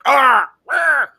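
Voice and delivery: high, loud cry of alarm, half-joking; mock upset cry. Husband recalling his wife keeping her name, mock cry. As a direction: yelp as if it hurt. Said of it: "at first I was like".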